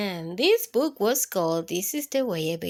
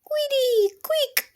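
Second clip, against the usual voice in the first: squeaky; 13 semitones higher than usual. Pretend.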